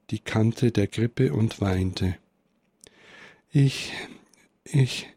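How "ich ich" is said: mildly halting, narrated sobbing; unter Schluchzen. A narrator's reading. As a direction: break it up with long pauses, as if holding back sobs. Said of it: "brachte unter Schluchzen hervor". (stockend, leicht schluchzend vorgelesen)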